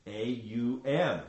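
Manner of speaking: A U M, each sound said slowly and separately; spelled out slowly. Shown as a demonstration. Teacher breaking down Om for listeners, slow and separate. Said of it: "everything centers around Om".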